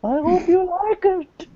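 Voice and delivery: very high, wailing manager impression; Paul Bearer. Manager asking wrestling fans for approval, high theatrical voice. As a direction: stay piercingly high while asking for approval. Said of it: "How's my Paul Bear impression".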